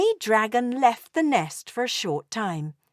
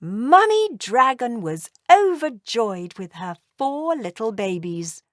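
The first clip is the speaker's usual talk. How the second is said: ordinary delivery, nothing marked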